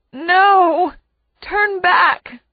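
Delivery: high, drawn-out anguished plea; moaning. A narrator's reading. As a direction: moan it out as a desperate warning. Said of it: "Mom moans".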